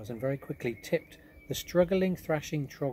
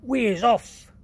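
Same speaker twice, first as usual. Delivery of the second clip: much higher, very loud giant cry; 11 semitones higher, 12 dB louder, slower than usual. Acted. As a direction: call out eagerly. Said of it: "cried the B F G".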